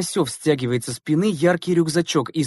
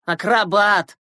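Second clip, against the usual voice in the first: higher, drawn-out mock acclaim; mock admiration; 6 semitones higher, 3 dB louder, slower than usual. A narrator's reading. Schoolboy jeering at a plump classmate boarding, mock admiration. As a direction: hail him like a circus star. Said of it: "восхитился Мухин".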